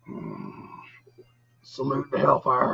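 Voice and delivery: ordinary talk, nothing marked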